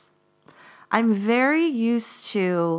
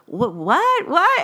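rising "what" jumping high and loud at the end; incredulous, building to a yelp; stunned disbelief; 6 semitones higher, 3 dB louder, slower than usual. Retold or imitated. Reacting to the blackout.

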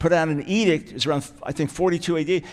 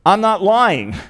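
louder, insistent "I'm not lying"; emphatic; 3 semitones higher, 5 dB louder, slower than usual. Shown as a demonstration. Pastor stressing Paul's third emphasis, louder and insistent. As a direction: say it like a firm vow. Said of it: "Emphasis number three".

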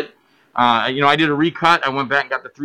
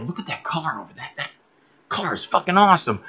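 hushed aside; 5 dB quieter than usual. Retold or imitated. Nudging his assistant director about a car, hushed. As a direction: keep it down, just between us.

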